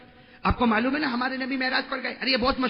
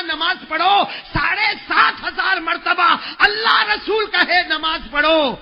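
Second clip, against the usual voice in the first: high, loud shouted sermon; full-force preaching; 8 semitones higher, 7 dB louder, slower than usual. Acted. Preacher roaring at the congregation, full force. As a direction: thunder it out at the crowd.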